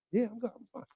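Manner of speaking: softly, holding energy back; quiet reserved. Retold or imitated. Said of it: "I be like".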